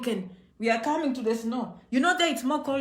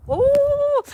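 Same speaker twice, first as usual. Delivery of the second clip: high shrieking ooh from the cold; cold squeal; 14 semitones higher, 8 dB louder than usual. Real, not acted. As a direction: squeal at the icy air.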